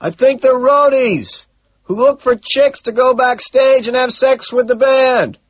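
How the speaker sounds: slow, high, sustained yell; loud shouted explanation; shouting back. A narrator's reading. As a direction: holler it word by word over the noise. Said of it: "Owen shouts back".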